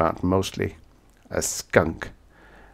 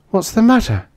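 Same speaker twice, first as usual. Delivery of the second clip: frightened; 5 dB louder than usual. A narrator's reading. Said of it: "She looked frightened".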